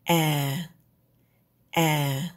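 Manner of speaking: A said low and held low. Shown as a demonstration. Teacher demos the low falling tone. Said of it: "Start low and stay low".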